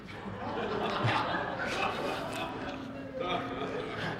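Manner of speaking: long breathy laugh at his own punchline; real laugh. Real, not acted. Preacher laughing after his own joke punchline, long breathy laugh. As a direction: let the laugh roll out.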